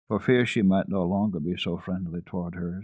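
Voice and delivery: ordinary delivery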